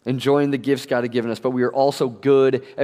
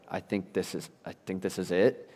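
quiet despair; 5 semitones lower, 9 dB quieter than usual. Retold or imitated. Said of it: "and I'm just like".

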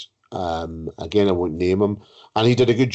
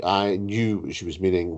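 normal talking voice

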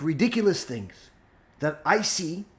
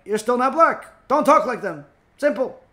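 louder, higher mock rebuke; acted scolding; 6 semitones higher, 6 dB louder than usual. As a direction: put your foot down, loudly. Acted listener scolding the rabbi.

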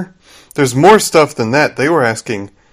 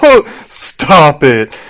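drawn-out, playful mock protest; joking stop it; much slower than usual. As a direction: stretch it out as though it's all a joke. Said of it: "She's, like, laughing".

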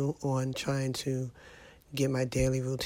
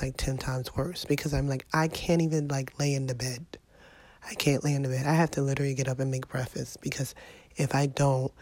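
ordinary delivery

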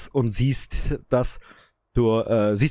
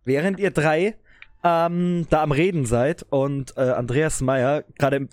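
ordinary talk

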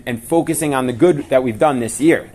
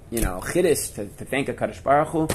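normal talking voice

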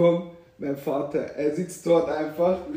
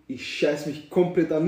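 ordinary talk

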